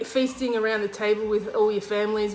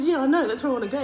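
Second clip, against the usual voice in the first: higher, louder mock-impatient reply; retold exasperated insistence; 5 semitones higher, 5 dB louder, much faster than usual. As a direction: insist to a doubting bus driver, playfully retold. Retold exasperated replies to driver.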